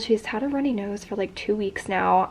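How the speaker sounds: ordinary delivery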